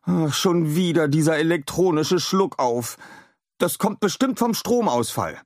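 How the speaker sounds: weary groan of a scientist; stöhnend. A narrator's reading. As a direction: sigh at the old glitch again. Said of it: "Adam stöhnte". (Adam stöhnt genervt über den Schluckauf)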